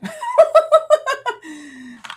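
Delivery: high loud burst of laughter; exasperated laugh. Real, not acted. Laughing at her own anger with viewers, loud laugh. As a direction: laugh out the frustration.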